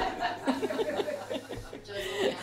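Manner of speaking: breathless high laugh. Real, not acted. Preacher laughing at his own joke, breathy and high. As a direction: laugh at your own bit.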